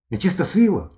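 put-on high-pitched wondering boy; boy's question. A narrator's reading. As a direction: ask it high, as a persistent boy. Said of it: "спитав він".

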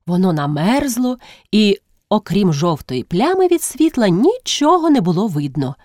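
ordinary delivery

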